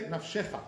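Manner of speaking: Nafshecha as naf-SHE-cha; wrong stress on SHE. Shown as a demonstration. Teacher showing what not to say, Nafshecha stressed mid-word. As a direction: punch the middle syllable of Nafshecha. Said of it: "This is not correct".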